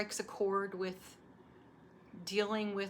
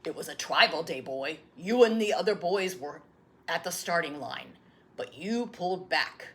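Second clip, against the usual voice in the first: a patient grandfather answering; grandfather's voice; 2 semitones lower, 8 dB louder than usual. Acted. Grandfather tells the story.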